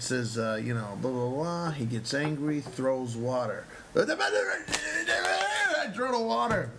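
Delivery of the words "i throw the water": very high, drawn-out mock scream; slow screeching rant, hammed up. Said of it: "He fucking screamed at me".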